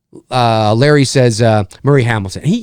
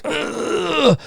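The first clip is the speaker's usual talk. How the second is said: strained grunt; 13 semitones higher, 5 dB quieter than usual. Retold or imitated. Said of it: "twenty were like".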